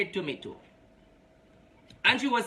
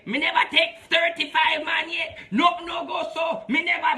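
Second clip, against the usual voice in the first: indignant denial; 7 semitones higher than usual. Retold or imitated. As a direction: shout her denial back, high and offended. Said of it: "She have the privilege to say".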